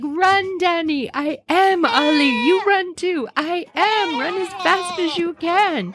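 high, breathless little goats urging each other on; high panicked goat chatter; frantic goat voices. Pretend. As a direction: squeak it out like terrified kids fleeing. Voicing fleeing goats.

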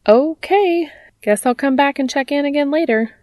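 high, loud, surprised okay; taken aback. Acted. Alex backs off after Dean's outburst, taken aback.

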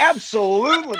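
high laughing yes; laughing agreement. Retold or imitated. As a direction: giggle through it. Answering the screen instructor.

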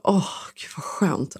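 slow, whispered sigh of relief; relieved whisper. Retold or imitated. Machine finally washing, slow whispered relief. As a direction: breathe it out like a secret comfort.

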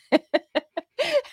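a sudden burst of high laughter; incredulous laugh at the absurd memory; real laugh. Real, not acted. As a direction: crack up in disbelief. Laughs at ex's outburst.

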